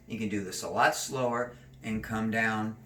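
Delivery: ordinary talk, nothing marked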